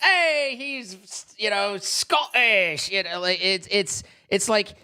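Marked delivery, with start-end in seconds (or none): 0.0-2.9 higher, exclaimed mock greeting with "Scottish" drawn out; mock delighted recognition. Streamer imagining a reaction to Scott Manley's accent, mock delighted recognition. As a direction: act out a hearty "Hey", stretching the last word. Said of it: "not of enough of an accent to where you're like".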